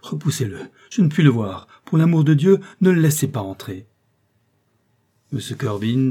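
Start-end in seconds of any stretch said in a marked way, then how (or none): 0.0-3.9 rising to a loud, high desperate plea; desperate cry. A narrator's reading. Prisoner begging the magistrate to keep the visitor away, in desperate cries. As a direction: beg them to keep him out, voice climbing. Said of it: "en m'écriant avec désespoir".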